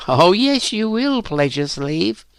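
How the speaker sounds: high, knowing taunt; taunting assurance. A narrator's reading. Elizabeth contradicts Phyllis, assuring with a taunt. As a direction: assure her teasingly, high-pitched. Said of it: "Elizabeth assured her".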